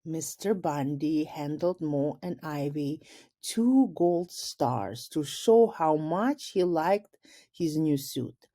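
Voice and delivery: plain reading voice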